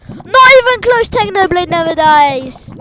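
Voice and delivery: high, loud yell; excited shout. Real, not acted. Fan hyping up Technoblade's motto, yelling. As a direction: scream it in triumph. Chanting a famous catchphrase.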